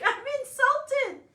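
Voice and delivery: drawn-out high indignant protest; hammed-up offense, slow and loud; mock insulted. Mom telling viewers her son's words hurt, mock indignant. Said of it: "I have to say, I'm insulted".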